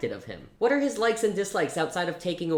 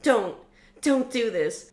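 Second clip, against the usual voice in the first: pleading; 7 semitones higher than usual. Acted. Blakely pleads with dying Riot, desperate. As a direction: beg him not to go.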